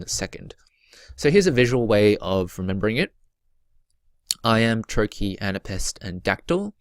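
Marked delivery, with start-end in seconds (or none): none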